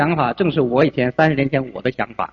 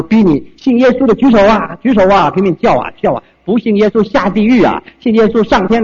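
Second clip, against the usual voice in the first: louder and higher, hammed-up hellfire preaching; mock preacher yelling; 5 semitones higher, 6 dB louder than usual. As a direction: imitate a preacher shouting people into heaven. (模仿传道人拼命大喊)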